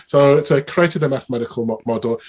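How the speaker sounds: usual speaking voice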